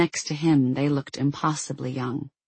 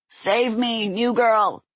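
higher, hammed-up dying rasp; 8 semitones higher, much slower than usual. A narrator's reading. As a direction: plead like a mock-wounded hero. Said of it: "he rasped".

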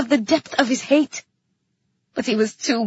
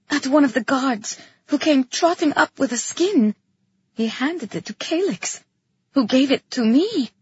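ordinary delivery

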